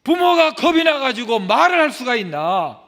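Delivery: loud high impassioned sermon delivery; preacher thundering at the congregation; preaching at full force. Acted. Preacher rebuking defiant children, loud and impassioned.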